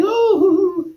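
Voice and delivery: a high, mock lovestruck "Oh."; hammed-up swoon; swooning fan. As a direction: squeal it like a smitten fan. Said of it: "waving money and saying".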